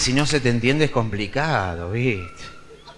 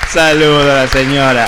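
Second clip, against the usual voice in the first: louder greeting to the lady; 7 dB louder than usual. Real, not acted. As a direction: call it out to her. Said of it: "greets lady loudly".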